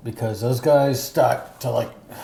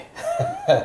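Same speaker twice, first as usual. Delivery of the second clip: high laugh. Real, not acted. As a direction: giggle up high. Laughing at the hot sauce.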